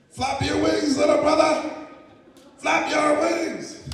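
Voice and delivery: urgent shouting. Acted. Elder urges falling eagle.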